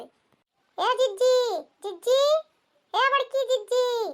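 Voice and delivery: long, slow, loud calls stretched out over a distance; calling out. Acted. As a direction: draw each call out slowly, as if hailing someone across the lane. Neighbour calling elder sister outside. (पड़ोसन का बड़की जिज्जी को धीरे-धीरे खींचकर ज़ोर से पुकारना)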